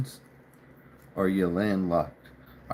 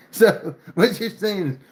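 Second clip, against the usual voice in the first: laughing; 9 semitones higher, 5 dB louder than usual. Real, not acted. Still laughing at the comment.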